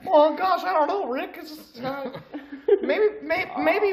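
put-on squeaky anxious teen boy; Morty voice. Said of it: "Morty, we are way off script".

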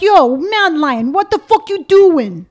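drawn-out New Yorker demo; loud, slow put-on New York accent. Shown as a demonstration. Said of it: "So you're from New York".

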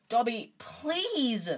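slow, high begging; high, stretched-out pleading; desperate plea. A narrator's reading. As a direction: draw out the plea in rising desperation. Harry begging Dobby to stop.

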